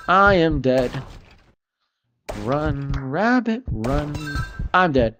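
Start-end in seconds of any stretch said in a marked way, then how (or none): none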